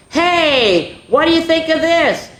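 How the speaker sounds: high, bright-eyed exclaiming leaf; excited exclaim. A narrator's reading. Leaf Pointy showing off his gold to friends, excited. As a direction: call out in delight. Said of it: "he exclaimed".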